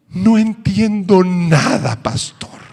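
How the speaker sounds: high, slow confession; young convert confessing to the pastor he understands nothing; bewildered convert. Retold or imitated. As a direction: admit you're lost, slowly. Convert admits confusion.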